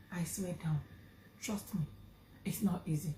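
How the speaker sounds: whispered confession, barely voiced. Real, not acted. Whispering to viewers about her loyal husband. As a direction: lean in and whisper it.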